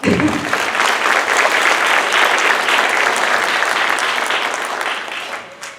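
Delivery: long loud real laughter. Real, not acted. Teacher laughs after applause.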